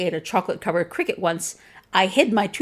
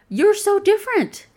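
far higher and louder, gushing; mock compliment; 12 semitones higher, 4 dB louder than usual. Quoting backhanded compliments, loud mock gushing. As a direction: overdo the fake praise. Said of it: "Compliments like".

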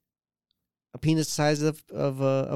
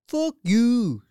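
high mock Canadian swearing; Canadian accent; 10 semitones higher, 4 dB louder than usual. Line-cutter swearing at a guy, mock Canadian accent. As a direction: curse high in exaggerated Canadian. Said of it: "I like when Canadians swear".

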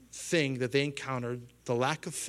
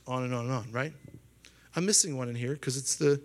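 usual speaking voice

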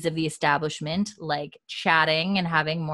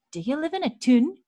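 high-pitched, badly faked Scottish question; terrible Scottish accent; 8 semitones higher, 3 dB louder than usual. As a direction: attempt a Scottish accent and botch it. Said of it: "you have a terrible Scottish accent".

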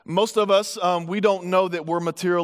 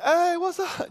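casual greeting; 10 semitones higher, slower than usual. Retold or imitated. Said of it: "you ain't gotta be like".

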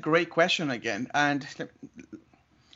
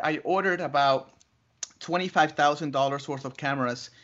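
usual speaking voice

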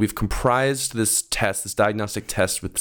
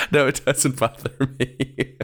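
slow, high self-mimicry; mimicking raised voice; 4 semitones higher than usual. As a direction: parody your own denial, high and drawn out. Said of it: "My voice went up".

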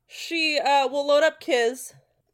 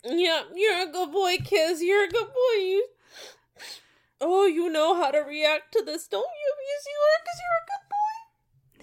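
put-on high baby-talk praise for an animal; sing-song pet voice, climbing higher; cooing to a pet; 6 semitones higher than usual. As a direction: coo it like to a good dog, a little teary.

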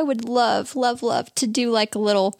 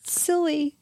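getting emotional; 7 semitones higher, 5 dB quieter than usual. Real, not acted. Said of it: "Why am I getting emotional?".

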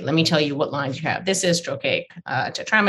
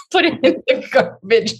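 mock stern teacher; 10 semitones higher, 5 dB louder than usual. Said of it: "And he goes".

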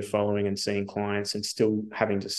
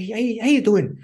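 high-pitched, loud Irish greeting; Irish mentor; 12 semitones higher, 5 dB louder than usual. Retold or imitated. Mentor ringing out of the blue, imitated Irish accent. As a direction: mimic an Irish friend, pitched right up. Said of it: "he's Irish".

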